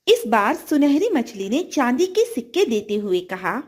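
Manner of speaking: ordinary delivery, nothing marked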